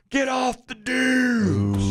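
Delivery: high, slow stretched-out shout; retold yell. Neighbor across the street yelling at dad and son, drawn-out shout.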